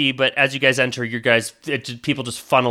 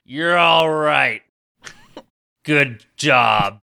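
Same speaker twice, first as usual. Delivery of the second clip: slow crowd voice; 3 dB louder, much slower than usual. Acted. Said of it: "He goes".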